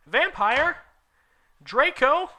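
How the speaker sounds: very high, loud baffled rising questions; Ebony shocked at the boys fighting. Acted. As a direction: squeal in disbelief. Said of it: "I asked".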